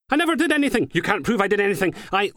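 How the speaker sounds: panicked denial. Acted. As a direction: squeal your innocence. Suspect panics caught out.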